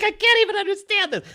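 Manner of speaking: high, fast, strained disbelief; squeaky disbelief. Retold or imitated. Telling a friend about the show, squeaking in disbelief. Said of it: "I'm like".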